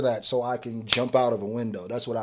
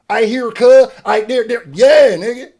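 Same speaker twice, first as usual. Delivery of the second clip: mock West Coast; 8 semitones higher, 10 dB louder than usual. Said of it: "Same thing with the West".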